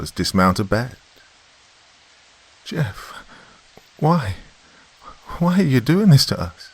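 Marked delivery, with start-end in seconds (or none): 2.6-6.7 high, halting frightened question; feeble plea. A narrator's reading. Mother cornered by her son, high feeble plea. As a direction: ask weakly, pitched high, with pauses. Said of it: "she asked feebly".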